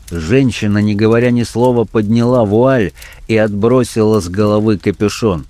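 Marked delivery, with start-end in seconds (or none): none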